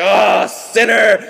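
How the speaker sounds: slow, loud mock-horrified shout; hammed-up accusing cry, long drawn out; exaggerated condemnation. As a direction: gasp it out as an outraged Pharisee. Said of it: "I'm exaggerating".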